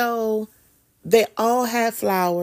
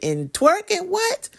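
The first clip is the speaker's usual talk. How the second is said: high, incredulous what; sharply higher squeak of disbelief; mock disbelief; 11 semitones higher than usual. Mock shock at the idea.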